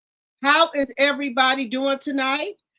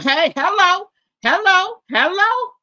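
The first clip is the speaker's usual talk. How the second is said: loud, high, sing-song hellos; excited greeting; 7 semitones higher, 8 dB louder than usual. Real, not acted. Streamer greeting chat arrivals, loud excited hellos. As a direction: welcome them in with a loud high call. Greeting new viewers loudly.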